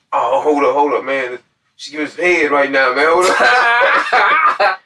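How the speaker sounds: brother stalling, ending high and laughing; mock brother brushing him off; distracted brother. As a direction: put him on hold, laughing.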